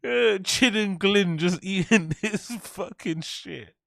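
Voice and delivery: cracking up while talking; laughing. Real, not acted. Cracks up at own joke.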